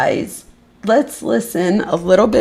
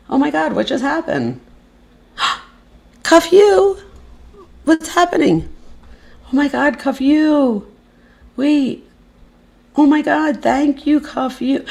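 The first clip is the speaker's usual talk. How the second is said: high-pitched, gasping disbelief; overwhelmed by a surprise gift; astonished; 10 semitones higher than usual. Real, not acted. Stunned by a surprise gift live.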